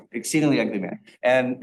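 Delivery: ordinary talk, nothing marked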